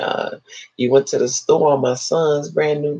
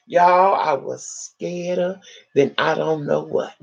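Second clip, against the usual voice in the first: ordinary delivery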